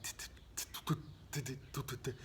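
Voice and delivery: soft, unvoiced tutting taps; imitating cat steps. Dad imitating the cat's strange footsteps, soft tutting. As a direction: tap out the cat's odd footsteps softly. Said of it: "It's like".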